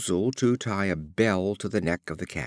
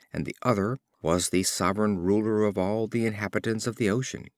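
usual speaking voice

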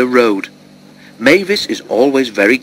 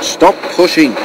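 annoyed growl, pitched higher; 5 semitones higher than usual. A narrator's reading. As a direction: growl it as an irritated order. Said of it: "she growled".

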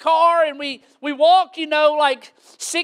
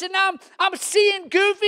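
usual speaking voice

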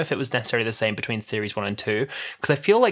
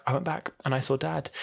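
softly, as if afraid to say it; soft retold line; 4 semitones higher, 6 dB quieter than usual. Daughter quietly telling mum she saw her dead dad, soft. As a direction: hush it out, gentle and hesitant. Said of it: "she's talking really soft at the beginning".

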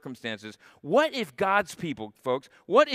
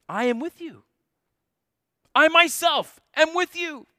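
climactic rising declaration; 12 semitones higher, 5 dB louder than usual. Acted.

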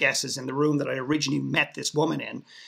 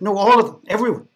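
louder and higher, pressing the point that everyone cried; retelling his friend's amazed insistence on the phone; excited insistence; 5 semitones higher, 8 dB louder, faster than usual. Retold or imitated. Friend on the phone to him, insisting everyone cried, excited.

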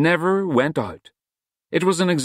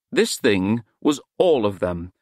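ordinary delivery

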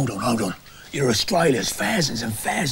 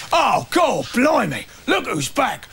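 loud, high cry of outrage; hammed-up soap-opera shock; shocked shout; 13 semitones higher, 6 dB louder, slower than usual. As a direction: yell it like he can't believe who's back. Neighbour spots returning villain.

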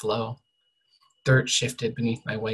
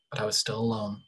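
usual speaking voice

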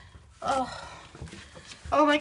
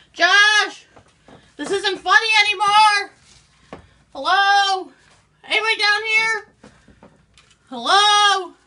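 loud, higher calls into the dark; frightened calling out; 6 semitones higher, 7 dB louder than usual. Acted. Searching a dark basement for Josh, loud frightened calls. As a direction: shout for anyone who might be there.

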